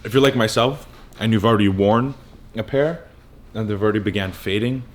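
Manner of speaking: ordinary talk, nothing marked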